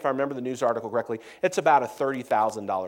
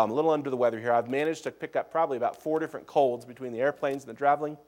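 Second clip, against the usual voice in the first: ordinary delivery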